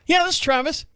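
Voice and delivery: picking up the phone to Mike, high and eager; high answer. Retold or imitated. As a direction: pitch it way up, eager. Answering Mike's call.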